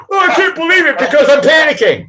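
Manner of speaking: high, squealing "Oh, I can't believe it"; mock panic. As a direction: mimic someone losing it. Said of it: "Why can't you believe it?".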